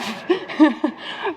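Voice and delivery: short, high-pitched real laugh; amused laugh. Real, not acted. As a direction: laugh at the end of your own long list. Laughs after long language list.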